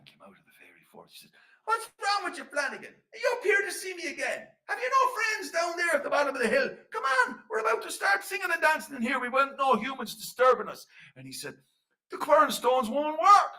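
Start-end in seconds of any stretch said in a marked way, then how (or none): 1.6-10.8 high, loud fairy voice; irritated little man. Acted. Little man complains of humans interrupting the dancing, high and testy. 12.1-13.5 high, drawn-out wail of protest; loud complaint. Acted. Flanagan tells the little man the Quernstones failed, wailing loudly.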